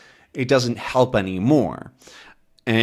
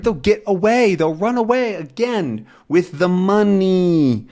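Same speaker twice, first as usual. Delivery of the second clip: urgent plea; 8 semitones higher than usual. Acted. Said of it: "he says".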